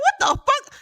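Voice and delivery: high and loud, what the fuck; outraged shout. Retold or imitated. Player yelling at the stranger, loud outraged shout. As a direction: yell it, fully offended.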